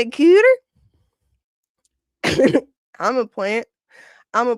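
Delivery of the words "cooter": sudden high yelp of a word; shouted punchline. Real, not acted. Laughing at a chat joke, sudden high yelp. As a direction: blurt it out high and loud.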